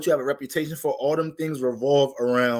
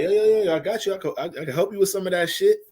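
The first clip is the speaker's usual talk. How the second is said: eager hustler voice; 5 semitones higher, faster than usual. Retold or imitated. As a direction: pitch up like an eager guy jumping in. Eager guy offering help, quoted.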